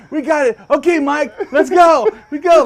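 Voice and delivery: high, loud cheering-on; Kevin. Retold or imitated. Storyteller voicing his friend bouncing on the bumper, urging. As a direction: holler it like urging a horse.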